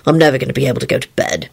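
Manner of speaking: low, quick, resigned; resigned sigh. A narrator's reading. Lupin complains to Rosie, resigned sigh. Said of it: "he sighed resignedly".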